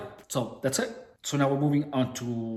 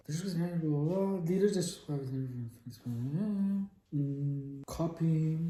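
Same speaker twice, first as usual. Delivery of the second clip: quiet muttering; 9 dB quieter, much slower than usual. Real, not acted. Muttering while copying text.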